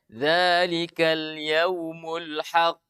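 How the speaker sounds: slow, even recitation with a normal bounce on "haqq"; ordinary recitation. Shown as a demonstration. Teacher showing the correct plain reading. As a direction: recite "haqq" simply, with no exaggeration. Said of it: "biasa saja".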